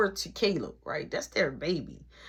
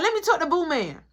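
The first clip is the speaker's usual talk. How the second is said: blunt demand; 7 semitones higher than usual. Retold or imitated. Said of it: "Straight like that".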